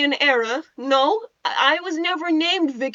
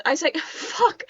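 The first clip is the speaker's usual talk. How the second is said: usual speaking voice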